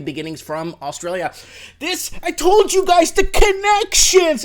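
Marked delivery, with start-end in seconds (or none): 1.8-4.4 much higher and louder, slowed; I-told-you-so, stretched and loud; vindicated outburst. Real, not acted. Vlogger telling viewers he predicted it, high and loud.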